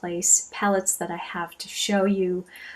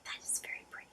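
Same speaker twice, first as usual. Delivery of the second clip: barely audible, voiceless whisper; awed whisper; 22 dB quieter, much faster than usual. Real, not acted. Vlogger admiring the swatch on the fingers, hushed whisper.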